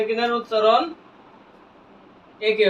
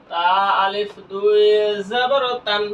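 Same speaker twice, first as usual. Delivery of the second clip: slow, stretched letter-by-letter spelling; teacher's slow spelling drill; drawn-out spelling recitation; slower than usual. Shown as a demonstration. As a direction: spell it out slowly, holding the long vowels. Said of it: "বানান করলাম".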